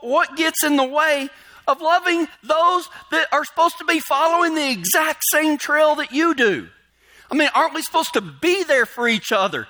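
high-pitched, insistent sermon delivery; impassioned preaching. Real, not acted. Preacher urges congregation to love fellow believers, impassioned. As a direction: press the point home, voice pitched high.

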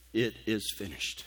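soft, low, breathy final words; Jesus' dying words, spoken softly; hushed last words. Acted. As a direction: let it fall away quietly.

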